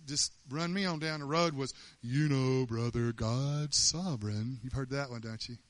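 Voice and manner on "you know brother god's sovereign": slow, lightly voiced churchy platitude; a hint of a smug church brother; mock pious saying. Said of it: "some of our favorite sayings".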